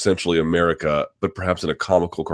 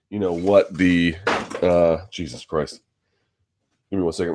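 sniffling. Real, not acted. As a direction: talk through a runny nose.

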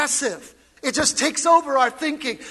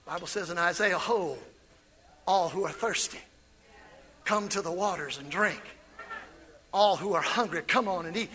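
low scripture read; 9 semitones lower, 10 dB quieter than usual. Real, not acted. Pastor reads Isaiah softly. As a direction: read it tenderly.

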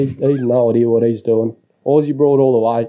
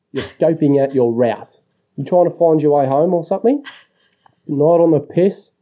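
ordinary talk

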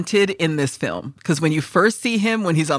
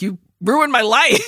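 mild mock yell; 6 semitones higher, 5 dB louder than usual. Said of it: "screaming at each other".

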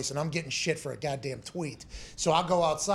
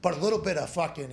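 usual speaking voice

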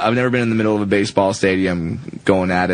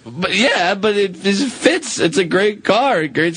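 high insistent; 9 semitones higher, slower than usual. Real, not acted. Insisting car fits.

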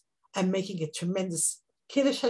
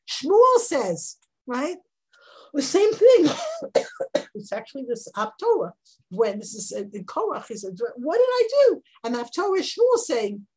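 raised, urgent sermon voice; pleading sermon at full force; impassioned preaching; 5 semitones higher, 4 dB louder than usual. Acted. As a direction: preach it with rising fervour. Impassioned teaching of Shimshon's plea.

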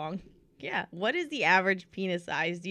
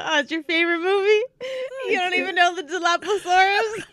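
high-pitched mocking imitation of a friend; mimicking the co-host; 10 semitones higher, 3 dB louder than usual. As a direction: parrot her indignation in a high voice. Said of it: "The best one you go".

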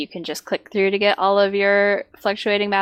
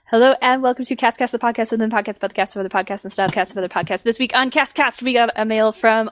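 podcast host intro; 3 semitones higher, faster than usual. Pretend. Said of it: "are you ready for Cast Cast mailbag".

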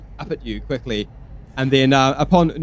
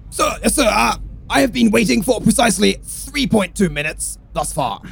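soldier voice; 7 semitones higher than usual. Acted. Private answering superior officer.

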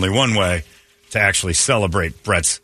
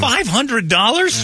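slow, high disbelief; drawn-out shocked question; surprised; 12 semitones higher, much slower than usual. Real, not acted. Host stunned by birthday money.

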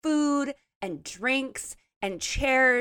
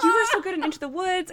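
fast, high, laughing fan gush; 9 semitones higher, much faster than usual. Retold or imitated. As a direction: blurt the compliment like a starstruck fan. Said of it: "I was like".

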